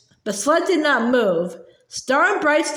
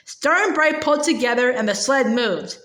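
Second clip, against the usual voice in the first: ordinary delivery, nothing marked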